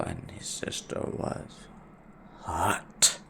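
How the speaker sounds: breathy hushed whisper on hot; whispered confession. Real, not acted. Telling viewers about his friend's hot sister, whispered. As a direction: let hot slip out in a whisper.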